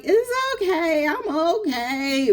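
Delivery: high, slow forced reassurance; pretending to be fine. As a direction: insist you're fine, voice lifted high. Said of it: "And they're like".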